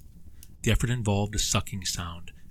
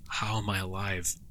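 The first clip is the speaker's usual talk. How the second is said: usual speaking voice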